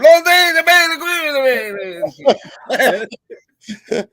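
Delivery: put-on high, loud hammy Italian accent. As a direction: ham up an Italian accent as the scrap man. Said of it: "my Italian, uh, accent".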